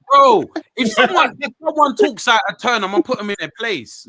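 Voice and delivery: loud, high, through laughter; high-pitched protest riding on a laugh. Real, not acted. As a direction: defend yourself while still cracking up. Laughing while defending himself after teasing.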